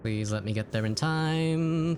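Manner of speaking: drawn-out high pleading; slow dramatic plea. Pretend. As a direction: stretch it out, begging. Pleading with game timer.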